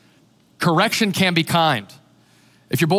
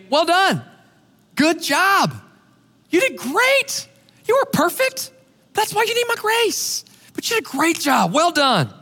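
Jesus praising; 11 semitones higher than usual. Retold or imitated. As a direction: lift the voice high and gentle, like a proud father. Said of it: "I'm gonna hear Jesus say".

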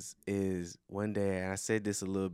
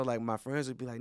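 normal talking voice

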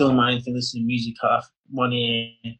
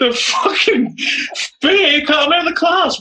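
much higher and louder; exasperated outburst; 14 semitones higher, 4 dB louder, slower than usual. Real, not acted. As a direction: let it burst out in disbelief. Outraged disbelief retelling.

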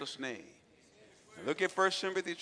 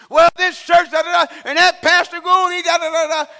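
higher, loud mock yelling rant; mock ranting man; 10 semitones higher, 20 dB louder than usual. Pastor imitating an angry man shouting outside the church. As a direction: ham up a ranting lunatic shouting. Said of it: "This is what the lunatic said".

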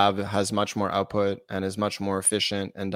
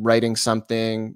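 usual speaking voice

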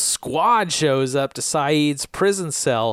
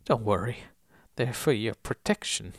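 soft Southern voice; 3 semitones lower, 4 dB quieter than usual. Acted. Recapper as Oldham calming his prisoner, soft Southern voice. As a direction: soothe in a gentle Southern accent. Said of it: "He says in a soft southern voice".

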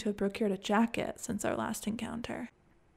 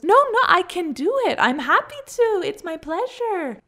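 very high, loud, delighted insistence; trilling, eager waiter; 9 semitones higher, 8 dB louder than usual. A narrator's reading. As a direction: trill it effusively, overeager to please. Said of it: "he trilled".

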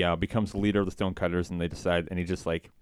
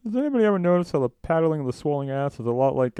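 high, different voice, louder; imitating Homer; 9 semitones higher, 4 dB louder than usual. Homer jokes during the initiation, high voice. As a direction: pitch it up like a cartoon character. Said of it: "and he goes".

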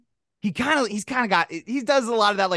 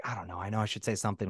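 quiet dying person; 11 semitones lower, 11 dB quieter, much faster than usual. Pretend.

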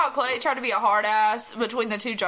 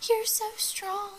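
soft, high, hushed mock admiration; quiet, high-pitched put-on voice; Ani; 9 semitones higher, 8 dB quieter than usual. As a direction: gush it slowly in a small, high voice. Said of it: "Ani's just like".

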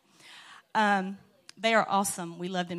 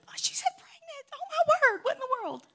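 much higher, squealing disbelief; shocked teen reaction; faster than usual. Retold or imitated. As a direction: squeal it like a brother who can't believe it. Son laughing at sister's words.

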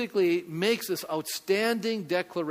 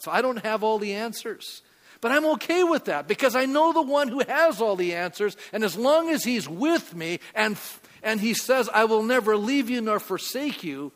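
rising, louder preacher's declaration of trust; preaching climax; 4 semitones higher, 5 dB louder than usual. Acted. Pastor closing his sermon to the congregation, fervent preaching. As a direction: lift it to the close of the sermon with conviction.

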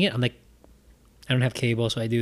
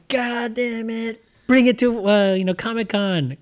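frustrated; 10 semitones higher, slower than usual. Retold or imitated. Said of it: "I was like, going".

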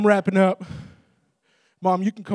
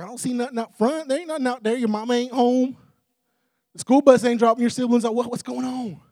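higher, mock-baffled father; puzzled dad; 6 semitones higher, 3 dB louder than usual. Dad telling his son nothing is out front, mock puzzlement. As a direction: lightly act a dad running down what he can't see.

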